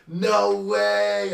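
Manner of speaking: loud, high outcry of disbelief; shocked disbelief. Retold or imitated. To himself at the bar, a loud shocked no. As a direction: yell it like you just got ditched. Realizing he got ditched.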